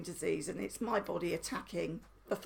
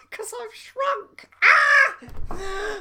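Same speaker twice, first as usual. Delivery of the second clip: high, loud laughing squeal; 12 semitones higher, 12 dB louder, much slower than usual. Real, not acted. Laughing at her own shrinking height, squealing.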